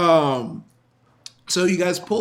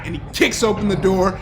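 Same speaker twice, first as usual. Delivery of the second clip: ordinary delivery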